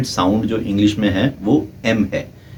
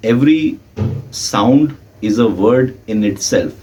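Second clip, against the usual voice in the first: slow emphatic statement; much slower than usual. Shown as a demonstration. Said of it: "key point stated slowly".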